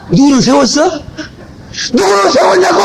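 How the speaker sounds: high, mocking yell of rebuke; mocking shout. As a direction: throw the challenge at them, shouting. Preacher mocks, shouting rebuke. (누가 세웠냐고 비웃으며 외치기)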